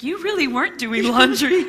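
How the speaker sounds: loud laughing accusation; laughing tease. Retold or imitated. Sister teased about the laundry excuse, laughing. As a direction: blurt it through laughter.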